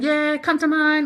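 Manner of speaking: mock a mate's slow, high invite; imitating a mate's invitation. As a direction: call out an invitation, stretched and high. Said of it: "That's him saying".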